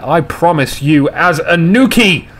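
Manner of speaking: ordinary talk, nothing marked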